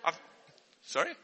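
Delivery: usual speaking voice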